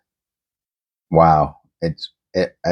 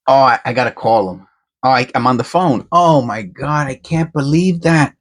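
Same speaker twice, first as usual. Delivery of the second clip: breathless excitement; 6 semitones higher than usual. Real, not acted. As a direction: still buzzing from the find. Still reeling from the find.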